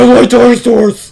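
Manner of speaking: high, loud hammed-up enthusiasm; mock excitement. Echoing the co-player's dinosaur glee, mock excited yell. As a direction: blurt it like an overexcited kid.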